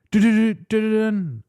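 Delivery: high, loud sung-out drum roll; mock drum roll. Podcaster teases the year reveal, high mock fanfare. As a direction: build suspense with a vocal drum roll.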